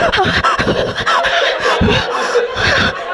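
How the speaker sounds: high breathy melodramatic laugh; hammed-up soap cackle. Soap opera diva running from somewhere, high cackle. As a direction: throw out a telenovela diva's cackle. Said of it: "They're like".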